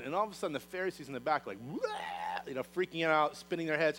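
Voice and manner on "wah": startled low yelp. Acted. Pharisees freaking out.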